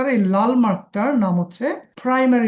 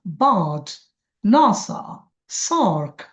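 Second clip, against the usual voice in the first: Bird, NASA, SAARC with strong, long openings; stress on first syllable; much slower than usual. Shown as a demonstration. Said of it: "এটা প্রথমে জোর দিতে হয়".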